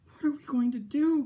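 a mother at her wits' end; high frantic wail; distraught cry. Acted. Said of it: "cried Missus Cream".

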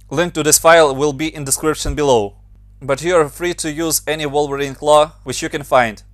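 normal talking voice

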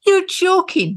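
you can't believe it, go right up; surprised disbelief. Real, not acted. Co-host hearing about child's extractions, shocked and high.

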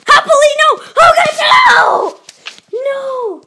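high, loud desperate shout; loud cry of despair as he loses. Real, not acted. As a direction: yell it in despair. Desperate in-game fight.

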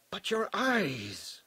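high, drawn-out lament; stricken, stretched-out cry without loudness; dismayed. Acted. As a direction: exclaim slowly in anguish. Stilgar dismayed at Paul's blinded eyes.

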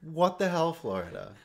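high, loud what the hell; outraged disbelief. Real, not acted. Host reacting to edited yearbook photos, outraged disbelief. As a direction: blurt it out in shock.

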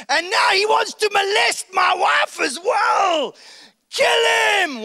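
enraged royal command; furious king. Acted. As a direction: explode at the insult to his wife. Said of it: "The king's furious".

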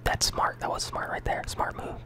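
whispering with a mouthful of jawbreakers; sucking candy. Real, not acted. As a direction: talk around candy in your mouth. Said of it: "I can't even really talk like this".

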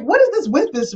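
high-pitched retold bafflement; puzzled friend. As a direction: imitate a friend who is mystified. Friend puzzled by the unknown name.

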